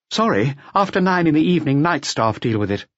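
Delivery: high-voiced, helpful hotel employee; hotel receptionist. A narrator's reading. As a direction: answer as the front-desk clerk, pitched right up. Receptionist answering inspector in dialogue.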